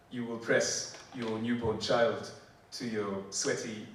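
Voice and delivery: ordinary talk, nothing marked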